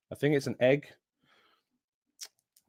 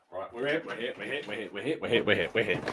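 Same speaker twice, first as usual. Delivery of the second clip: approaching from off mic; 8 dB quieter, faster than usual. Real, not acted. Hurrying back up to the microphone.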